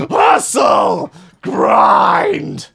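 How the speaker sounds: high, loud hype-man slogans; shouted hustle-guru chant; mock Gary Vee shouting. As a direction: yell them like a motivational hustler.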